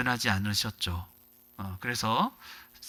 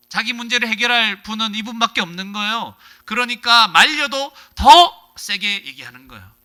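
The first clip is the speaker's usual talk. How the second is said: fervent preaching; 7 semitones higher, 10 dB louder than usual. Real, not acted. Preacher stresses the man cried harder, loud fervent preaching. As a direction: drive it home with force.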